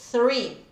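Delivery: Three, tongue between teeth, lips pushed forward; three with a pout. Shown as a demonstration. Teacher models three with a pout for the class. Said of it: "a língua continua entre os dentes".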